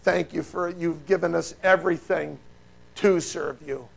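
soft, low closing prayer; quiet prayer. Acted. Preacher praying over the congregation, soft and low. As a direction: pray it humbly, hushed.

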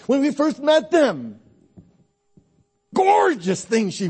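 loud, rising pulpit preaching; full-force preaching. Acted. Pastor urging the congregation to rekindle love, in a loud preaching voice. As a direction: preach it out and stir the crowd.

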